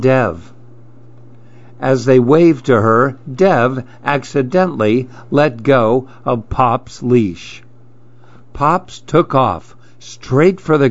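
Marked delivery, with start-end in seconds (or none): none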